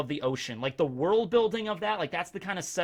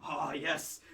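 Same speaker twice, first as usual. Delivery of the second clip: ordinary talk, nothing marked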